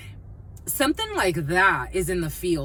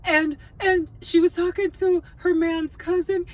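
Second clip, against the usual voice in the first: higher, louder gossipy voice; imitating the friend; 9 semitones higher, 4 dB louder than usual. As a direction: play the friend spilling the tea. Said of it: "and she's like".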